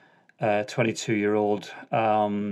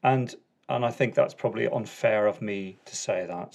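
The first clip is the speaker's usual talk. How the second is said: normal talking voice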